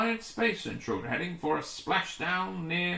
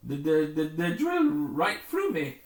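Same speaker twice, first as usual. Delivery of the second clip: fearful stammer; 4 dB louder than usual. Acted. Stammering in fear of drill.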